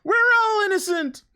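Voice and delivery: playfully wailed protest; mock protest. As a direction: cry it out in mock indignation. Quotes a line in mock protest.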